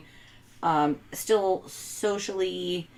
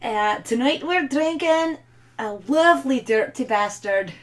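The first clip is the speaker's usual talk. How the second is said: hammed-up Scottish brogue; Scottish accent; 6 semitones higher, 6 dB louder than usual. Shown as a demonstration. Wife shows off her accent for the beer at husband's request, Scottish accent. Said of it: "do the Scottish accent of Dirty Bastard".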